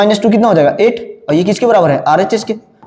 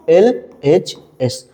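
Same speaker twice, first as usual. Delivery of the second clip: "LHS" as L, H, S with pauses, lower; letter by letter; 5 semitones lower, much slower than usual. Shown as a demonstration. Teacher answers own question for class, letter by letter. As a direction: slowly dictate each letter on its own.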